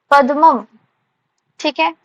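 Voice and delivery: ordinary talk, nothing marked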